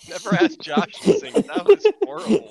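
laughing, voice jumping high; laughing tease. Real, not acted. Reacting to a friend's awful singing, helpless high laughter. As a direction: crack up while mocking the performance. Teasing after bad singing impression.

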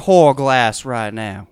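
slow southern command; southern drawl. Shown as a demonstration. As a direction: drawl it slowly. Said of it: "Southern Plantation owner".

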